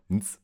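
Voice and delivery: "nz" as eins with only consonants; no vowels. Shown as a demonstration. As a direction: say "nz", just a hum and hiss. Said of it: "keine Vokale benutzt und die Zahlen". („nz“, eins ganz ohne Vokal)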